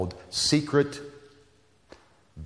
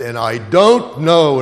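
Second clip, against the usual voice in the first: higher, louder, slow and trembling; 6 semitones higher, 11 dB louder, slower than usual. Retold or imitated. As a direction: let the voice shake as she fights tears. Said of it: "and her voice trembled".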